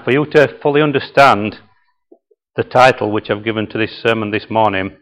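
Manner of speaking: normal talking voice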